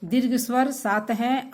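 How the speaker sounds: normal talking voice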